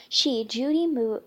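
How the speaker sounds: plain reading voice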